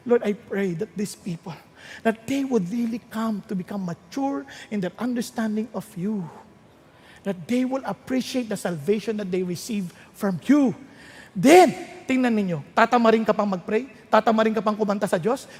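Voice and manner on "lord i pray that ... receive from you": soft, earnest prayer voice; softly pleading to God; heartfelt prayer. Acted. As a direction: pray it quietly for the people. Prays aloud for the people.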